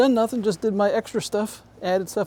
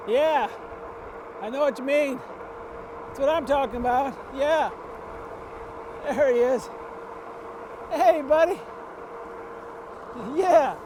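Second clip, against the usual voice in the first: higher friendly dog talk; 4 semitones higher than usual. Real, not acted. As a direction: greet the dog cheerfully. Talking to a chasing dog.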